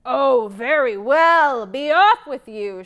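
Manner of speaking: loud, slow greedy troll; troll giving in greedily; shouting troll. Acted. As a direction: shout him away. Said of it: "shouted the troll".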